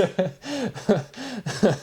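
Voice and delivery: long, high peals of giggling; laughing fit. Real, not acted. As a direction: keep giggling helplessly. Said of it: "that one made me laugh".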